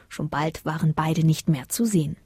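usual speaking voice

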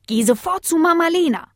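father putting his foot down; stern fatherly order. Acted. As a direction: send the child off firmly. Father orders pup away.

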